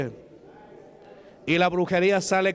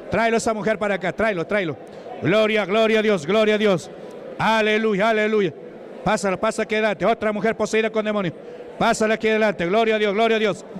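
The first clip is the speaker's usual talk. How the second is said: full-force exorcism preaching; 4 semitones higher than usual. Real, not acted. Preacher praising God as the possessed come forward, full-force chanting. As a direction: drive each praise out forcefully.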